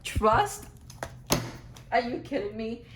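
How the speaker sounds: real disbelief. Real, not acted. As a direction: react to an uncanny coincidence. Card flips to the word trust.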